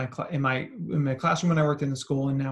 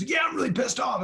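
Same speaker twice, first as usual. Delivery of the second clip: imitating an upset kid's high shout; angry outburst; 10 semitones higher than usual. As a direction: burst out indignantly, pitched way up. Acts the upset child's outburst.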